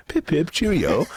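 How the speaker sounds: high, laughing mock British toff; a British tough guy suddenly turns prim, in a high mock British accent. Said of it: "and then he goes".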